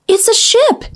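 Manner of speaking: high, breathless cry of delight; delighted gasp. Acted. Mermaid spotting a ship above, delighted gasp. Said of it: "she gasped with delight".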